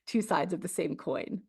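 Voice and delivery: ordinary talk, nothing marked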